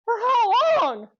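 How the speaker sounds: high, loud, slow outraged disbelief; shocked outcry. Retold or imitated. Son hearing he's grounded all summer, loud shocked outcry. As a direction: cry out in disbelief at the punishment.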